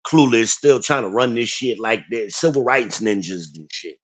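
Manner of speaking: normal talking voice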